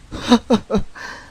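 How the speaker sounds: a short airless high laugh, breaking; nervous breathy laugh. Acted. Detective laughing off his fear, airless high laugh. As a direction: laugh at your own fear. Detective alone, shaken by threat.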